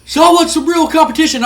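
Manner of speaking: high, loud shouted wrestling promo; Scott Steiner promo. Retold or imitated. Wrestler grabs the mic and calls out the tag teams, shouting high and loud. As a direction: bellow at the crowd like a heel wrestler on the mic. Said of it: "he grabs the mic and he goes".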